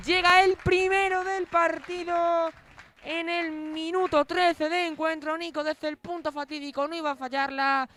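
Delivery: loud excited recap after the goal; breakneck goal commentary. Real, not acted.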